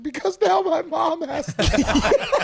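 high, slow laughing sob voice; mock George crying. George at the Oscars explaining why he cries, mock high wail. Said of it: "He's like".

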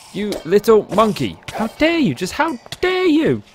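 high, slow mock outrage; hammed-up indignant squeal; shaking with anger.

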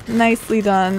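usual speaking voice

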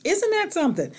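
high, amazed question. Real, not acted. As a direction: marvel at it. Amazed remark.

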